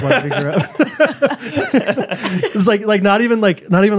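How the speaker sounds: giggling through the words; can't stop laughing while telling it; real laughter breaking into speech. Real, not acted. Laughing while describing son.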